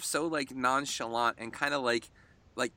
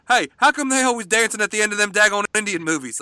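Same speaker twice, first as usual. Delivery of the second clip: hammed-up hillbilly yelling out a question; 5 semitones higher, 9 dB louder, faster than usual. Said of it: "in, like, a redneck voice".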